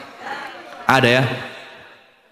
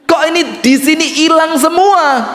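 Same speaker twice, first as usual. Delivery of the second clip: outraged woman; 10 semitones higher than usual. Acted. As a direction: cry out that everything is gone. (seruan tinggi penuh protes)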